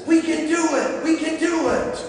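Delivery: high, cheering encouragement; rally the kids like a cheerleader; voice of God. Acted. Pastor voicing God to children, high and rousing. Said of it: "God says".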